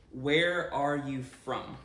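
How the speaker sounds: normal talking voice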